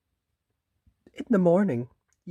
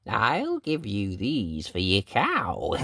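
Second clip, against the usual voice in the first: sly little man; slower than usual. Acted. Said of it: "said the little man".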